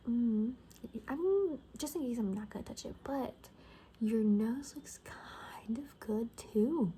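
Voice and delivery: normal talking voice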